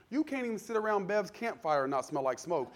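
usual speaking voice

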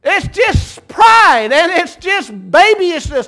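stretched-out hollering from the pulpit; slow shouted emphasis. Acted. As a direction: drag each word out, loud and high. Preacher hammering the point.

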